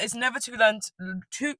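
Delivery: ordinary talk, nothing marked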